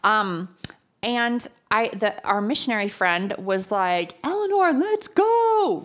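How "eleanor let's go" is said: high, loud call across the plaza; loud, high hurry-up call. Retold or imitated. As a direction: shout her name to hurry her along. Missionary calling her to move.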